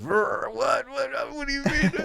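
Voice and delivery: hammed-up offended friend, laughing at the end; mock outraged Luke. Wee Man imitating Luke's offended reply in the car, mock outrage. Luke's angry reaction, retold.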